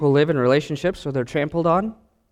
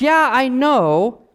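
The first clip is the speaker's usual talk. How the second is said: slow, higher, careless drawl; mock dismissive; 6 semitones higher, much slower than usual. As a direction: brush her off with a lazy shrug. Boyfriend shrugs off her complaint.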